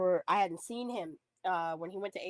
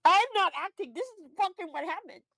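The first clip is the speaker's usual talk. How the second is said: high, worked-up insistence; genuinely upset, voice pitched up; emotional protest; 10 semitones higher, 4 dB louder than usual. Real, not acted. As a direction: insist through welling emotion. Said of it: "I'm getting emotional".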